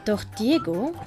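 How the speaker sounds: ordinary talk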